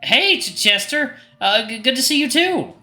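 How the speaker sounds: high-pitched nervous stammer; flustered. Acted. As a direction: panicky awkward greeting, far above normal pitch. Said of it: "my mini panic episode".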